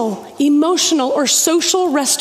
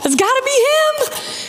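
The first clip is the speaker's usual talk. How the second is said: amazed onlooker; 10 semitones higher than usual. Retold or imitated. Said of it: "They're like".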